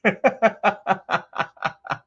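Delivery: a high burst of real laughter. Real, not acted. Streamer cracking up at the woman who loved the magic trick, high laugh. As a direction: crack up high and let it roll out.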